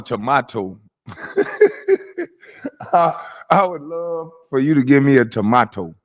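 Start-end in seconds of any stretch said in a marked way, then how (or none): 0.0-0.8 "tomato" with an "ah" in the middle; mocking "tomato", middle vowel as in "father"; mocked pronunciation. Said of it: "somebody saying tomato instead of tomato". 5.2-5.9 "tomato" said tuh-MAH-toh; mock "tomato" with a broad "ah"; "tomato" rhyming its middle with "spa"; mocked pronunciation. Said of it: "ran up on somebody and say".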